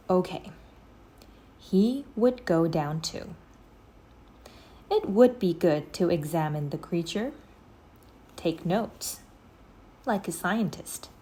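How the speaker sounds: plain reading voice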